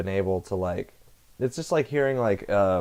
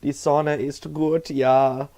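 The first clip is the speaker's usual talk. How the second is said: put-on slow, higher German accent voice; exaggerated German accent, savoring gut; super German voice; 5 semitones higher, slower than usual. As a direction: drawl die Sonne in a hammed-up German accent. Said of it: "That's my super German voice".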